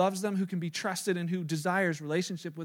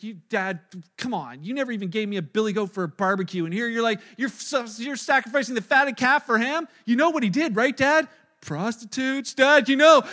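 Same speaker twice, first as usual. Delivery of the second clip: upset, indignant; 2 semitones higher, 8 dB louder than usual. Acted. Older brother complaining to Dad about the fatted calf, upset. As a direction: protest hotly, ending in a loud accusation. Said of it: "he's, he's upset".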